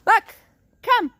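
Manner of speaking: very high call to the dog far off. Real, not acted. Trainer calling Buck in from the yard, high ringing call. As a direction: sing out his name high.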